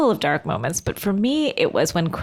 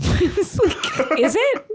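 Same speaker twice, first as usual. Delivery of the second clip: laughing; 9 semitones higher, much slower than usual. Real, not acted. Laughing at the quote.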